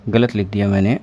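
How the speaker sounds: ordinary talk, nothing marked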